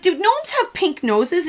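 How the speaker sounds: curious high question. Retold or imitated. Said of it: "I'm like".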